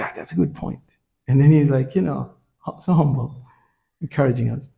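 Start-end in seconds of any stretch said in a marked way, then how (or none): 0.0-0.9 mock whispered remark; almost voiceless, as if to himself; hushed approving quote. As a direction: breathe it out like a quiet aside. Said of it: "He says".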